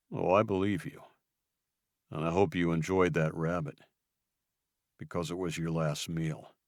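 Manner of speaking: calm, deadly promise; quiet menace. Acted. Luke threatening Julian, quiet and menacing.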